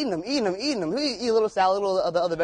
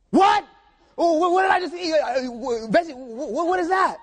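high, loud, stammering panic; horrified yelp over what he just ate; shocked disbelief; 10 semitones higher, 4 dB louder than usual. Retold or imitated. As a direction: freak out, voice jumping way up. Friend learns he ate vegetarian meatballs.

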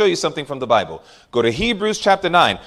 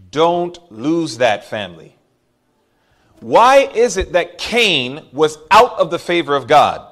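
normal talking voice

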